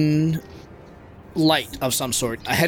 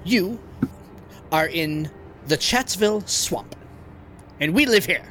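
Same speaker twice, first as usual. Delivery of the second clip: high indignant frog guard; 5 semitones higher than usual. Acted. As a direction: challenge them as a frog sentry.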